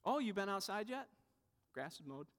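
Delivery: soft mock-casual brag; subtle hint. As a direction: imitate someone slipping it in offhand. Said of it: "We try these subtle ways".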